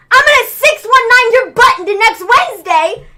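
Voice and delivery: loud wrestler threat, hammed up; mock threat. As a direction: bark it like a wrestling promo. Ray threatening in retold wrestling.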